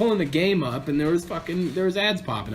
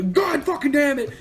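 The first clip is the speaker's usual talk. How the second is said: yelled curse, much higher and louder; furious shout; 14 semitones higher, 5 dB louder than usual. Retold or imitated. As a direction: explode in frustration at the screen. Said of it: "and go".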